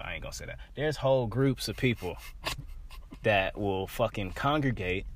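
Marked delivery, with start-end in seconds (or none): 0.0-0.6 whisper. Real, not acted. Stops himself, censoring.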